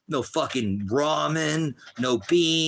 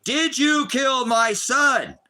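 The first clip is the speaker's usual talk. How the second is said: slow, high, loud anguished question; a father demanding answers, loud and slow; anguished plea; 11 semitones higher, 5 dB louder, much slower than usual. Retold or imitated. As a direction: beg the court slowly, voice straining. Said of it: "that's why I asked the courts".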